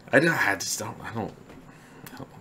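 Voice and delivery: ordinary talk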